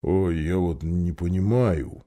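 low, soft, slow bear rumble; slow puzzled bear grumble; grumbling bear. Acted. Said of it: "проворчал он".

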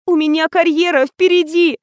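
high, wounded protest; trembling voice. A narrator's reading. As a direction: defend yourself near tears. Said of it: "дрожащим голосом произнесла".

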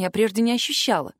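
ordinary delivery, nothing marked

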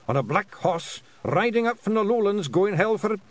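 high, loud Scottish report; pictsie pilot. A narrator's reading. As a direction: build up excitedly in a Scots voice. Pilot reports what he saw.

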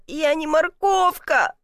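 slow, high-pitched protest; plead, stretching the words. A narrator's reading. Man being yanked out by rope, pleading. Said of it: "взмолился".

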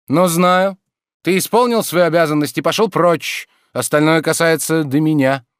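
loud, high-pitched dismissal; irritated. A narrator's reading. Master dismissing servant who woke him, irritated and loud.

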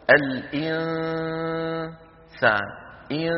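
الإنسان said slowly, the ن hidden; slow الإنسان with hidden nasal; slow demo. Shown as a demonstration. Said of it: "في إخفاء النون نقول". (الإنسان بإخفاء النون ببطء)

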